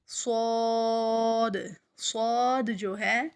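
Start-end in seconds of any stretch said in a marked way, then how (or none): 0.1-1.6 صاد slow, full-mouthed, lips not rounded; heavy saad. Shown as a demonstration. Tajweed teacher showing full-mouthed صاد. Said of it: "پر ادا ہوگا".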